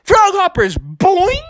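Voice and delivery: high, loud snap count; Froggy at the line calling hike; Froggy calling the play. Acted. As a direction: bark out the count.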